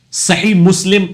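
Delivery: صحیح مسلم with a breathy h in sa-HEEH; sounded Arabic h. Shown as a demonstration. Teacher showing the h in the title, careful pronunciation. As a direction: say صحیح مسلم carefully, h audible, ending lim. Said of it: "صحیح میں بھی ہاں آتی ہے".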